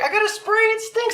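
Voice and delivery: very high imitated female voice; wife's protest. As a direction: whine it way up high, mocking her. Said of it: "She's like".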